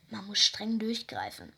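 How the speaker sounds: ordinary delivery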